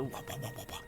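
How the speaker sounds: soft, low run of wahs; quiet, repeated "wah" effect; imitated jump noise. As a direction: mimic a game's jump sound under your breath. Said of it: "Samus jumping when she does the".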